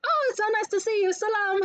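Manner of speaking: high, gushing friendly send-off; hella nice. Retold or imitated. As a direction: imitate over-friendly shop staff. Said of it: "was just hella nice to me".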